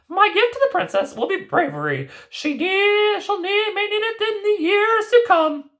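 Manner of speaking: high fairy-tale granny voice; grandmother voice. Acted. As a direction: play the knowing old grandmother. Grandmother character voice.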